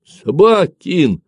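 high, drawn-out angry cry; spiteful shout. A narrator's reading. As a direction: shout the curse slowly and loudly. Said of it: "со злобой крикнул побелевший Ефимка".

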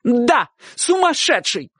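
high, drawn-out emphatic cry; shouted confirmation. A narrator's reading. Aunt answering uncle's question, shouting. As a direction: stretch the confirmation, pitched high. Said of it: "крикнула тётушка".

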